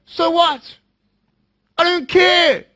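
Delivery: dismissive. Pretend. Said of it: "I'm gonna go".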